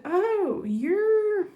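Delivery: exaggerated "oh", imitating a stranger's reaction; mock reaction. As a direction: act out a stranger's loud, high-pitched realization. Voicing strangers reacting to the accent.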